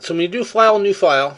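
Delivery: talking round a mint; sucking candy. Real, not acted. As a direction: mint in the mouth. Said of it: "sorry, I'm sucking on a mint".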